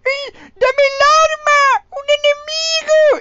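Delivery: very high, slow, shrill squeal of a cheap dub; mocking dub voice. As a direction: squeal like a stingy puppet.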